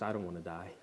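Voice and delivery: low, hushed candour; quiet confession. Real, not acted. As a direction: drop right down and admit it softly. Preacher's candid confession to congregation.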